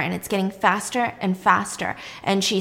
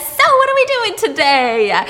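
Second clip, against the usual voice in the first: higher, louder chirpy greeting; perky morning voice, pitched up and loud; bright and cheery; 11 semitones higher, 6 dB louder than usual. A narrator's reading. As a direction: sound eager to start the day. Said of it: "bright and early".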